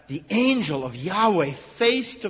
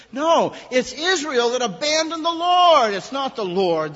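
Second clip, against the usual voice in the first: much louder, higher cry; emphatic outburst; 10 semitones higher, 8 dB louder than usual. Real, not acted. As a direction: cry out the correction with force. Preacher's emphatic correction.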